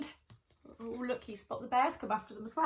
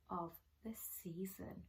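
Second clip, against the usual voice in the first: whisper; 4 semitones lower, 12 dB quieter than usual. Acted. Hushed end of suspense line.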